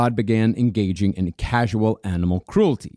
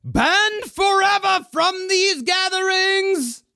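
1 mock dramatic rise.

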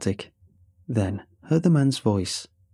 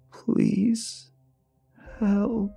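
very high, drawn-out strained plea; strained and gravelly; 10 semitones higher than usual. A narrator's reading. Old caller pleading as the worker questions him, strained and gravelly. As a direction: beg through damaged vocal cords. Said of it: "The words were strained and gravelly".

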